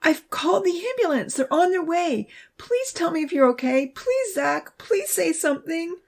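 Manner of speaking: high, anxious pleading; urgent and near tears. A narrator's reading. Abby begs hurt Zach to answer, high and anxious. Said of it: "the voice cried".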